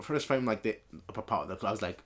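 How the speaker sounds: ordinary talk, nothing marked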